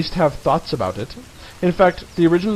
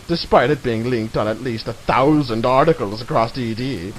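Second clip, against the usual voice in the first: usual speaking voice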